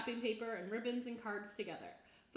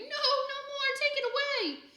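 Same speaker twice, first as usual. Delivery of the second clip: high, loud terrified scream; panicked child shriek; 9 dB louder, slower than usual. A narrator's reading. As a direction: scream it in terror. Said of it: "Sam started screaming".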